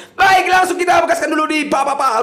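excited shout. Real, not acted. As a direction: yell it out to start the round. Starting the spin, hyped.

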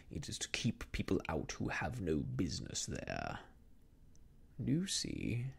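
smiling villain. A narrator's reading. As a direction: question her with quiet threat. Rucastle voiced by reader.

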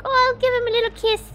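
put-on high sweet baby voice; cutesy kiss voice. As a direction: coo it like kissing a pet. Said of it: "I was like".

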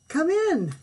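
high, loud booming welcome; booming king. A narrator's reading. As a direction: boom it like a jolly king. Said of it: "said the king with a boom".

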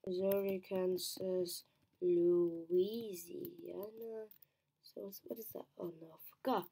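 usual speaking voice

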